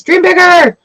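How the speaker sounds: high, loud cheer of dream bigger; shouting encouragement. Real, not acted. Reader cheers Sagittarius viewers to dream bigger. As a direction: yell it to the viewer to push them. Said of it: "shouted encouragement".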